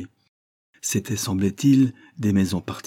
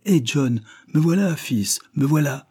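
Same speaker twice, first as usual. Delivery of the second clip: calling out; 7 semitones higher than usual. Acted. Father calls to his son.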